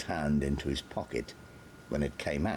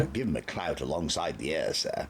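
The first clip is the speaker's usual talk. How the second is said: much louder, gruff trooper voice; blunt offer; 11 dB louder than usual. A narrator's reading. Trooper Gabatas answers the Major, blunt and loud. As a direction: offer a clout like it's obvious. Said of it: "said Trooper Gabatas".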